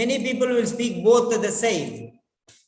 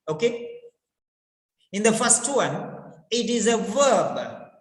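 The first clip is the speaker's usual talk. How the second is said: ordinary talk, nothing marked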